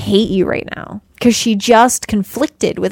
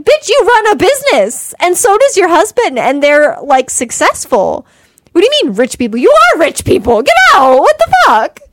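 higher, louder, building to a yell; exasperated rant; 8 semitones higher, 5 dB louder than usual. Retold or imitated. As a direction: build from disbelief to yelling at her. Ranting at the movie wife.

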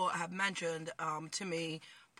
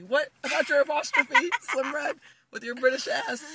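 loud, higher, laughing jab; teasing call-out; 5 semitones higher, 8 dB louder than usual. Pretend. Host ribbing Slim Red about apostrophe, teasing. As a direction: heckle an absent friend through laughter. Teasing absent British friend.

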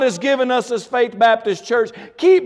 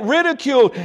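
usual speaking voice

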